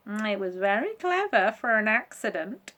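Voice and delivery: a sly teasing tone; monkey voice. Acted.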